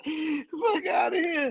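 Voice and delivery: words squeezed out through laughter; crying with laughter, slowed; laughing. Real, not acted. Laughing hard at the joke.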